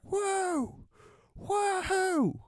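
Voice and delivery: high, drawn-out impressed whoa; mock crowd whoa. Crowd reacting to comeback.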